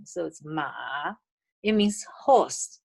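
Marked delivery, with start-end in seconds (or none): 0.5-0.7 "ma" low and soft, dipping then lifting; low falling-rising tone. Shown as a demonstration. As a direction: let "ma" sink and rise. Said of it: "It's low falling rising".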